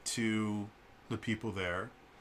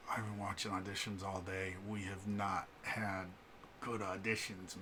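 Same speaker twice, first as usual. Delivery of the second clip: hushed confiding; 3 semitones lower, 12 dB quieter than usual. Retold or imitated. Leans in close to confide.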